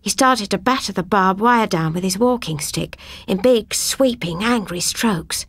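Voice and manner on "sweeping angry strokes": ordinary delivery, nothing marked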